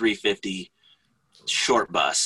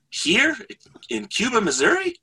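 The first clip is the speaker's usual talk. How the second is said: much higher, squeaky disbelief; incredulous clerk; 14 semitones higher than usual. Retold or imitated. Hotel clerk cannot believe the cyclist stops here, high incredulous squeak. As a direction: act amazed and baffled.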